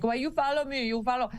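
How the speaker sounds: hammed-up fake Spanish accent, broken English; mock a fake Spanish accent, half-joking. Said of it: "fake Spanish accent".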